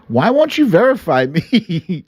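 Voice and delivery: ordinary talk, nothing marked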